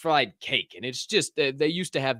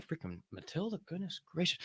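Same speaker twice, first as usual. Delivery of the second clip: much softer muttered oaths; mild grumbling over background noise; muttered exclamations; 6 semitones higher, 13 dB quieter than usual. Real, not acted. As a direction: grumble it almost to yourself.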